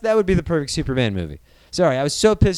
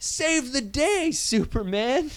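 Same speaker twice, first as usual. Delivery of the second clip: pleading high; 9 semitones higher, 5 dB quieter, slower than usual. Retold or imitated. Begging Superman, high take.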